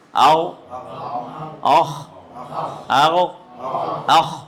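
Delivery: اوخ rasped hard at the back of the throat; throat letter drill. Shown as a demonstration. Teacher demonstrating throat letters for students. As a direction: push اوخ and آغو from the top of the throat. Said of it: "جزم لگائیں اس حرف پر".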